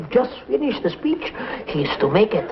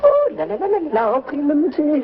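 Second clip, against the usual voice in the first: flamboyant Frenchman; much faster than usual. Acted.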